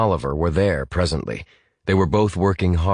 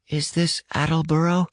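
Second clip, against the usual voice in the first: put-on young caller; 10 semitones higher than usual. Lost boy checks whom he phoned, high and tentative. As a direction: ask it high and careful, like a child.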